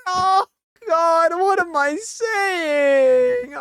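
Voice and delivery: high, crying-ish lament; slow high wail. Real, not acted. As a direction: wail slowly at yourself. Mock cry at game.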